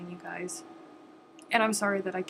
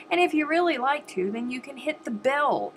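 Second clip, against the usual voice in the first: eager push; 6 semitones higher, 4 dB louder than usual. Real, not acted. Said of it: "Just hit the button".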